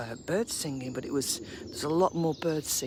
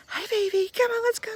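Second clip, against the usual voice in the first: high doggy talk; 7 dB louder than usual. Real, not acted. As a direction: coax the dog along in a high, bright sing-song. Calling her dog to come.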